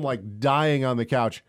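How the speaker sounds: usual speaking voice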